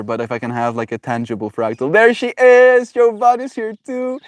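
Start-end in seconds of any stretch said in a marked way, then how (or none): 1.9-4.2 excited greeting. Real, not acted. Host spotting Jovan joining the space, excited high greeting. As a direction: sing out a delighted hello, stretched and loud.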